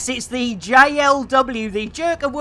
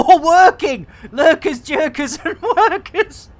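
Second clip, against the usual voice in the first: higher voice bubbling with laughter; laughing; 6 semitones higher, 5 dB louder than usual. Real, not acted. Streamer tickled by a new community name, laughing. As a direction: giggle through the words. Amused by own rhyme for community.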